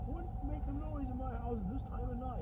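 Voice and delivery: grumpy homeowner. Retold or imitated. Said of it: "the guys trying to".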